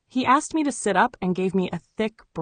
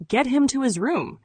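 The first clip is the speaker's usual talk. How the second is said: a curt order, pitched up; 6 semitones higher than usual. A narrator's reading. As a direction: command the orderlies briskly. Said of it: "he ordered the orderlies".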